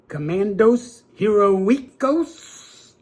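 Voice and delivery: very slow, drawn-out Spanish-accented "Commandos Heroicos". Shown as a demonstration. Collector trying the Mexican title again, slow Spanish accent. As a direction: stretch "Heroicos" out the Spanish way, rising at the end. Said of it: "sorry for my accent".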